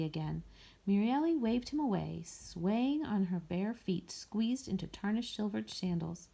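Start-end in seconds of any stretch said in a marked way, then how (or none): none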